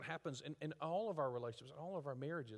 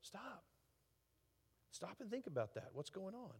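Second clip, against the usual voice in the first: soft, much quieter counsel to stop; calm pause; 8 dB quieter than usual. Retold or imitated. James quietly counsels an angry listener to stop. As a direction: hush them gently into thinking. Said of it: "James would say".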